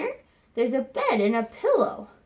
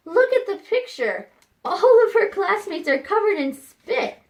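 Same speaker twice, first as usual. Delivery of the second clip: higher and louder, eager pointing; excited; 10 semitones higher, 5 dB louder than usual. Real, not acted. Teacher pointing at spit-covered kids, excited. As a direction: rev up the class about the gross picture.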